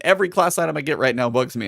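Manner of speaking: ordinary talk, nothing marked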